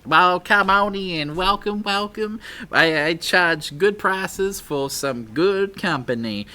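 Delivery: slow, high, loud Western drawl welcoming guests; hammed-up cowboy accent ending in a whoop. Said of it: "I can't do that accent".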